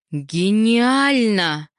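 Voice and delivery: very slow, swelling high exclamation; awestruck, drawn out. A narrator's reading. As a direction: stretch it out in breathless awe. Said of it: "с замирающим голосом по слогам сказала она".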